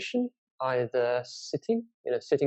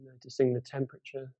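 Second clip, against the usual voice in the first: guided meditation. Acted. Teacher leads listeners through a body scan from the toes, guided meditation. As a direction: guide them unhurriedly through a body scan, leaving space between phrases.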